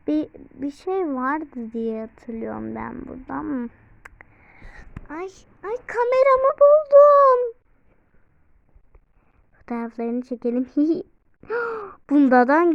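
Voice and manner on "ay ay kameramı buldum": excited squeal. Real, not acted. Finds her camera in game.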